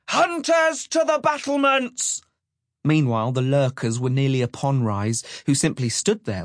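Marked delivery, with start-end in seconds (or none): 0.1-2.2 bellowed alarm. A narrator's reading. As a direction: bellow the order as a lookout raising the alarm. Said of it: "he bellowed".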